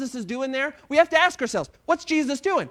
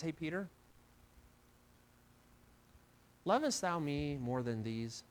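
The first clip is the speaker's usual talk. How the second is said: hushed Jesus; 7 semitones lower, 11 dB quieter than usual. Acted. Jesus quietly asks Peter.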